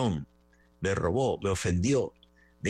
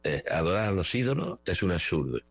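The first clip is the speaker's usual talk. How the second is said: ordinary talk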